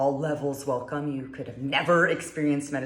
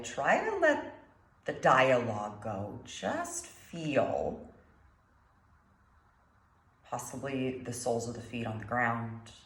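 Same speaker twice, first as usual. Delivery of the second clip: calm meditation guide, long pauses between phrases; guided meditation; 4 dB quieter than usual. Acted. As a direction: guide them gently through the breath, unhurried.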